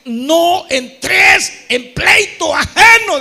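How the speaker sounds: very slow, loud scripture proclamation; preaching the verse at full force; scripture shout. Real, not acted. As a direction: drag each word out for the crowd. Quoting scripture.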